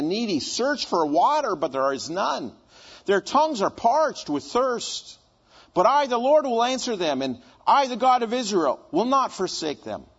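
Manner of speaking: scripture reading. Acted. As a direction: proclaim it like a prophet.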